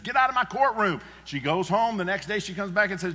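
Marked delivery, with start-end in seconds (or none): 0.0-1.0 higher, louder judge's bark; mock angry judge. Judge chasing the widow out of his courtroom, mock shout. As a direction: throw her out of the court, hammed up. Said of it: "the judge says".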